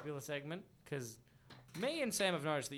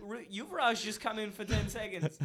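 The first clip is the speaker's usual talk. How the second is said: much higher, laughing praise; 10 semitones higher than usual. Real, not acted. Praising a friend's Indian accent, laughing much higher. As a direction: gush through laughter. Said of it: "laughing while praising".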